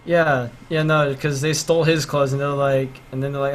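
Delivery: ordinary delivery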